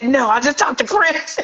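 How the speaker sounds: high, laughing insistence; retold excited protest breaking into laughter; freaking out. Daughter insists to disbelieving mother, freaking out. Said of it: "now we both freaking out".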